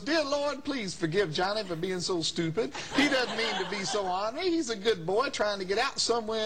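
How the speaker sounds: high-pitched mock prayer; imitated reverend praying. Reverend prays aloud for his threatening caller, high imitated prayer.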